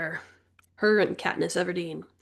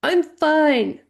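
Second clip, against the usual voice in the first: embarrassed protest; 8 semitones higher, 6 dB louder than usual. A narrator's reading.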